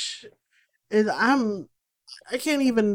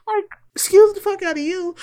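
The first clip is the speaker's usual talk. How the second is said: much higher, louder sassy exclamation; indignant outburst; 11 semitones higher, 5 dB louder than usual. Real, not acted. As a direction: let the outrage spike high and loud. Outraged rant about ableism.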